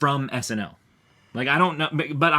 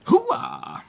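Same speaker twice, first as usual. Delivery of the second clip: high whooping army cheer; 14 semitones higher than usual. Acted. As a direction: bark it like a pumped-up soldier. Hype cheer before the show.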